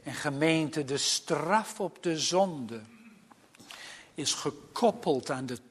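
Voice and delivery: zachte, lage, plechtige preekstem; plechtige preek. Acted. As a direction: spreek gedempt en gewichtig, met lange pauzes. (plechtig en ingetogen prediken)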